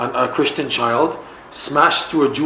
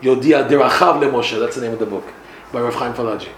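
plain reading voice

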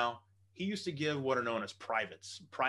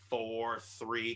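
ordinary talk

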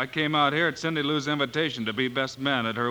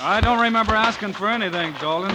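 higher, louder, squaring up; defiant challenge; 7 semitones higher, 5 dB louder than usual. Acted. Marshal squaring up to the groom, loud and defiant. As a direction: call his bluff out loud. Fistfight challenge between Bill and Dalton.

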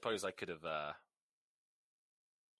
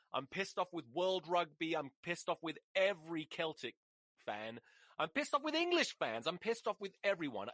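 exasperated list, pitch raised; real ranting anger, voice riding high; pissed-off rant; 4 semitones higher than usual. Real, not acted. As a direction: pile up each grievance emphatically. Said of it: "This is the most angry I've been".

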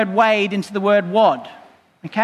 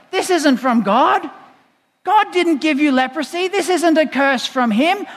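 higher, indignant protest; cross correction, pitched up and insistent; 6 semitones higher than usual. Acted. Preacher voicing Jesus to the leper, getting cross. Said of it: "getting really cross about that".

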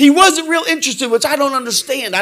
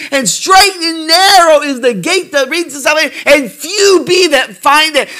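raised, fervent preacher voice, louder; full-throated preaching; preaching at full force; 4 semitones higher, 3 dB louder than usual. Acted. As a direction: preach it out with mounting wonder. Preaching on being saved.

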